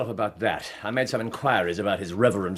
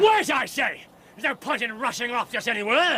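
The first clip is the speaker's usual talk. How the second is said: urgent shout; 13 semitones higher than usual. Acted. Urgent chase, stopping companion.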